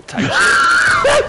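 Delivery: high unvoiced screeching shout; eagle screech. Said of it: "you know how freedom sounds, right?".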